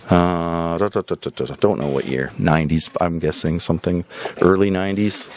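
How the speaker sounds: ordinary talk, nothing marked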